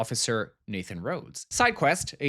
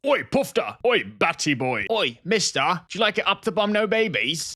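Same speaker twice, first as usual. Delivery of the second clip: put-on louder English accent taunting; British teen bully; 6 semitones higher, 5 dB louder than usual. Homophobic English teen taunting, English accent. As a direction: jeer in an English lad accent. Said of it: "my impression of a homophobic teenager".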